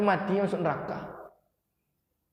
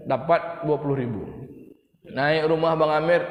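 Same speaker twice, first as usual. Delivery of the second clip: ordinary talk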